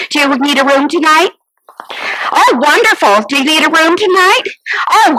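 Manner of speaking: usual speaking voice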